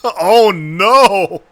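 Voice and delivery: loud, real laughing shout of dismay. Real, not acted. Streamer cracking up at the cartoon with a loud, laughing oh no. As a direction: cry out between laughs.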